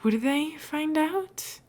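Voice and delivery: timid question. Acted. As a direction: ask it small and uneasy. Etho voiced, timid question.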